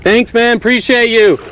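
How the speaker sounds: calling thanks. Real, not acted. Angler thanking the photographer from afar, called out. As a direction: shout your thanks over the water.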